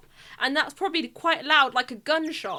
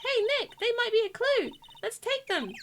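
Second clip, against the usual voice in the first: put-on high girl voice; 11 semitones higher than usual.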